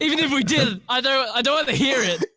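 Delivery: high, loud rant through laughter; laughing exasperation. Real, not acted. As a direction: protest loudly while cracking up. Laughing rant about being asked.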